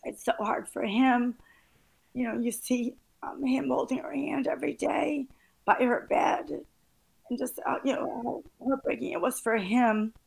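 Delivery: crying while pushing on speaking. Real, not acted. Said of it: "I'm sorry, I'm crying".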